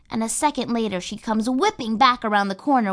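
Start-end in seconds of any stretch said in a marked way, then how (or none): none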